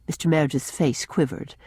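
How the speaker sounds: plain reading voice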